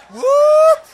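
high, loud laughing whoop; zipper whoop. Retold or imitated. Son imitates zipper noise.

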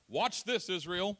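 loud, high, eager call; excited announcement. Acted. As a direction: show off to the people. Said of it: "he was excited".

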